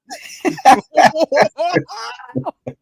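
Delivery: loud high laugh; big laugh. Real, not acted. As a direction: burst out laughing. Laughs after gimmick name.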